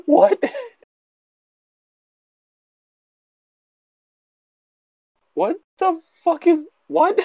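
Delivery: high, incredulous what the fuck; reacting to an absurd game text in high disbelief; stunned disbelief. Real, not acted. Friend reading a bizarre article aloud, high and incredulous.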